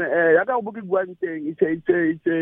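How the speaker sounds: ordinary talk, nothing marked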